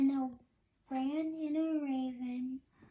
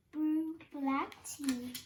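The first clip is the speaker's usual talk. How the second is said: plain reading voice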